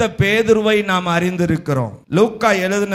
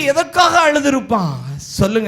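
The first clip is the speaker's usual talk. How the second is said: usual speaking voice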